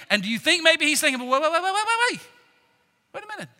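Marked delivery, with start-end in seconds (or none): 1.2-3.5 rapid-fire repeats climbing high; alarmed interruption. Acted. Peter realizing the kingdom is at risk, alarmed.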